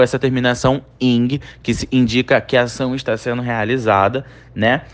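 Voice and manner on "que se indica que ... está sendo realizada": ordinary talk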